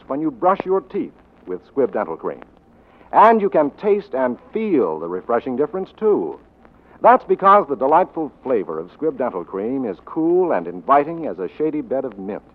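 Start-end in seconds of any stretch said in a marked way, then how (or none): none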